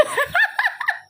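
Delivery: very high shriek of laughter; squealing laugh. Real, not acted. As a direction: shriek with glee. Teasing about Fajar sulking.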